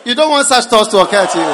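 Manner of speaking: loud shouted joke through a laugh. Real, not acted. Preacher shouting a joke to students while laughing. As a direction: tease them loudly.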